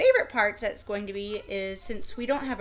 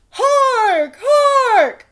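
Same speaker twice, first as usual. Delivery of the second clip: much higher, much louder herald's call; crier shouting; 12 semitones higher, 13 dB louder than usual. A narrator's reading. Royal crier announcing to the court, loud high calls. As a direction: bellow it across the courtyard. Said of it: "the royal crier shouted".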